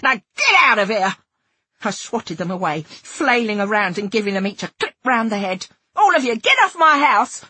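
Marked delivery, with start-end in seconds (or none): 0.0-1.2 loud, indignant shout; louder, outraged order; angry yell. A narrator's reading. Yelling at a child looter. 5.9-7.4 high, loud angry shout; outraged shout to a group; furious yell. A narrator's reading. Driving children off her ruined house.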